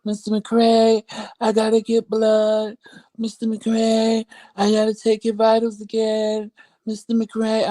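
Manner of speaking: high, drawn-out mock nurse calls; slow sing-song mimicry of a nagging nurse; imitated pestering nurse. Mimics nurses repeatedly waking her.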